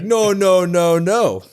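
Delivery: high, loud repeated no's; insistent no. Retold or imitated. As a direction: cut him off, loud and firm. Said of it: "He's like".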